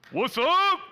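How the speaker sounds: loud, high shouted greeting; men's shout. Shown as a demonstration. Pastor cueing the men to call out a loud greeting. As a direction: shout it back like the men's crowd.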